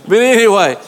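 ordinary delivery

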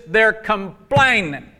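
"complaining" pulled out slowly, syllable by syllable; slow, pointed stress on "complaining"; drawn-out emphasis. Real, not acted. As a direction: drive home "complaining" to the congregation. Repeats it pointedly at the Baptists.

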